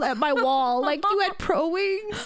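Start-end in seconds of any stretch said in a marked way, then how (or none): 1.1-2.3 higher-pitched incredulous question; mock disbelief, pitched up; retold surprise. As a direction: act out your surprised reaction. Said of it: "I was like".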